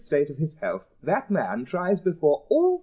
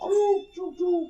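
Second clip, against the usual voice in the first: mock train noise; 13 semitones higher, slower than usual. Answering choo noise.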